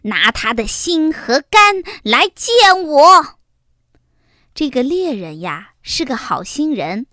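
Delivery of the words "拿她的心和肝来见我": loud, furious royal command; angry queen. Acted. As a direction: order the killing in a rage. Furious queen orders hunter.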